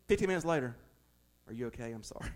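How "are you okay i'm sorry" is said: much softer and lower, tender and sorry; soft apology. Pretend. Parent quietly apologizing to the child fifteen minutes later. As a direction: gently make up after the fight.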